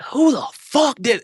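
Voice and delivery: high, drawn-out indignant demand; retold yelling. Comic demanding who started the rumor, high indignant yell. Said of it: "In the chaos of me, like, yelling".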